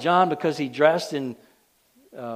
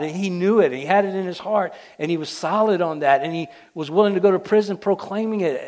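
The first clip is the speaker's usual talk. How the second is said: higher, louder preaching build; preaching at full force; 5 semitones higher, 5 dB louder than usual. Acted. Preacher pressing the congregation about John's faith, rising and louder. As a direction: drive the list home.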